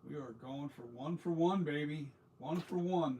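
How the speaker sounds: loud, pumped-up cheering; excited cheer. Real, not acted. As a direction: hype yourself up, loud. Cheering himself on at work.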